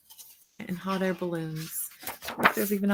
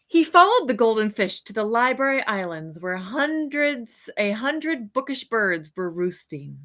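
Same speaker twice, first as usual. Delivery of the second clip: put-on bright, lively read-aloud for children; storybook reading; 2 semitones higher, 4 dB louder than usual. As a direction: read it like a bedtime picture book to kids. Reads picture book aloud.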